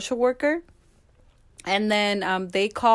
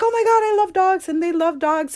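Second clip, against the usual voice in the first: high, loud retold gush; acting out delighted surprise; excited reenactment; 9 semitones higher, 5 dB louder than usual. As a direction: gush over a shared love, pitched up. Retold delighted reaction about dogs.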